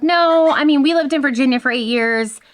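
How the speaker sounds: disbelieving protest. Retold or imitated. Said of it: "And I'm like".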